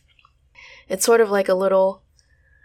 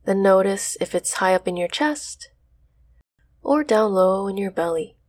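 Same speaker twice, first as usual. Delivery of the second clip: guided meditation. Acted. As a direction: ease them into stillness.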